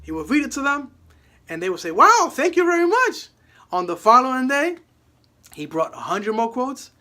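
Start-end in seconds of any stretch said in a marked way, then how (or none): 1.9-3.3 delighted thanks. Retold or imitated. Grateful customer reacts to quote.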